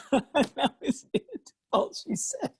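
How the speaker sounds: chuckling through the words; laughing. Real, not acted. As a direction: laugh as you say it. Laughing at the memory.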